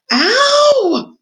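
long, loud, high-pitched "ow"; pained scream. A narrator's reading. Roscuro losing his tail, screamed in pain. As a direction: scream it out in one held high note. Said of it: "screamed Roscuro".

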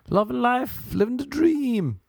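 very high, squeaky mock-cheerful voice; high-pitched sarcastic glee; mock joy. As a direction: leap way up high as if blissfully happy. Mock joy of homeless people.

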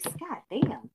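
usual speaking voice

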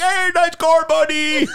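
high, bright mocking quote. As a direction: squeak it out like a goofy impression. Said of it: "That's all I said".

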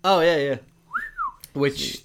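ordinary talk, nothing marked

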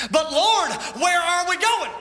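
high, loud, anxious plea; pleading church. Acted. Church crying out to God about where to go, high and loud. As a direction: cry it out up to heaven, worried.

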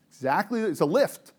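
ordinary talk

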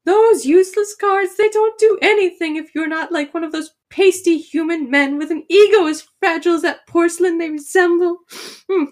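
put-on high, grumbling old gnome woman; fast, muttering granny rant; little old grandma voice. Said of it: "She's like".

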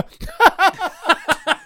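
high-pitched forced cackle; fake laugh. Shown as a demonstration. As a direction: demonstrate an obviously faked laugh. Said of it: "What do you mean fake laugh?".

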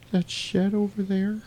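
mock little girl. Girlfriend pointing Marty to the shed, slow high mock-girl voice. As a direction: imitate a spooked child.

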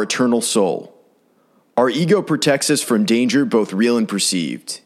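measured meditation guide with long pauses; calm, deliberate narration, falling at phrase ends; guided meditation. Acted. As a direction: lead them calmly through each breath, leaving space between phrases.

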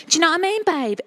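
cheeky Essex babe; essex accent. Retold or imitated. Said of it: "There's the Essex for you".

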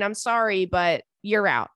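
normal talking voice

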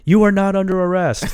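loud, high mock shout from the cop; retold cop shouting across the car. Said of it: "shouting out".

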